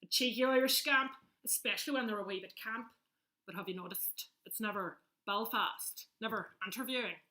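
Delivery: cheeky Irish lilt; playful Irish brogue, in verse; Irish accent. Acted. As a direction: recite it as a cheeky Irish scamp. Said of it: "we love an Irish accent".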